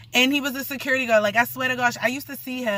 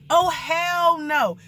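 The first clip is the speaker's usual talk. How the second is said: slow, drawn-out shout, pitched up; refusing shout; 5 semitones higher, much slower than usual. Retold or imitated. Refusing her partner's second round, a slow drawn-out shout. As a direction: yell it like a flat refusal.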